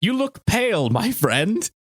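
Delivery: high, light mock Popo line. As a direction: toss it off as a wry Popo. Imitating Mister Popo line.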